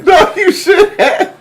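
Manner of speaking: very high, slow laugh-talk. Real, not acted. Cohost agreeing while cracking up. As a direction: squeal it through laughter.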